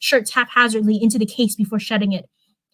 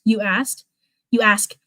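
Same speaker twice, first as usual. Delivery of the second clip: ordinary delivery, nothing marked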